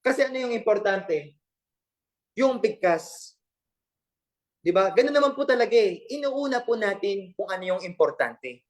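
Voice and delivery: normal talking voice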